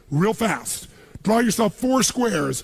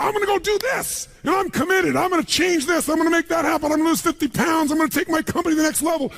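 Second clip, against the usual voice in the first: much higher, shouted pumped-up promises; excited vow; 10 semitones higher than usual. Retold or imitated. Speaker voices an audience member vowing big goals, excited shout. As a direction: shout your resolutions, fired up. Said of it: "you get out there and you go".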